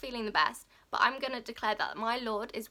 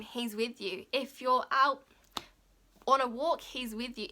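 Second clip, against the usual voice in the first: ordinary delivery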